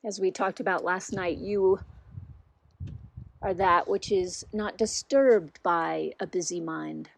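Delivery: unhurried guiding voice with long pauses; guided meditation. Real, not acted. Teacher settles listeners into body, mind and heart, unhurried guided meditation. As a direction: lead them gently inward, leaving long silences between phrases.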